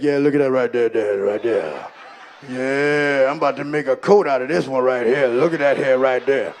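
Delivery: put-on low, loud, drawn-out teen bravado; cocky teenage son. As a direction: puff up like a newly grown boy, voice dropped deep. Son proudly admiring armpit hair.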